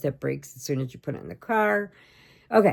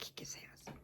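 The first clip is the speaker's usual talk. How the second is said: whisper; 28 dB quieter than usual. Retold or imitated. Whispered aside about brother.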